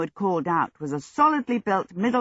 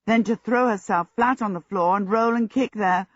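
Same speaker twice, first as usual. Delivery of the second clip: ordinary delivery, nothing marked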